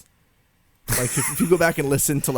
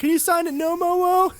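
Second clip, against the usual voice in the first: very high pleading fan voice; eager fan. Acted. Fan asking the star to sign, high put-on voice. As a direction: pipe it up like an excited autograph hunter. Acts an eager autograph seeker.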